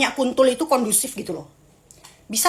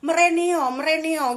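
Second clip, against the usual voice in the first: lightly imitated Javanese call, higher pitched; higher Javanese summons, lightly acted; Javanese, imitated elder calling; 4 semitones higher than usual. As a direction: beckon as a Javanese elder would, raised pitch. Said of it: "tapi pakai bahasa Jawa".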